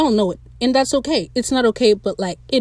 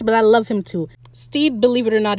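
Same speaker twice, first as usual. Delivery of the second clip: ordinary delivery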